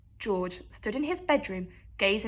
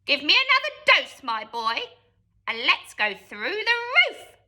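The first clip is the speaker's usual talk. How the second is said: put-on screechy old voice; 3 semitones higher than usual. A narrator's reading. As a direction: screech it from above. Grandma demanding from attic.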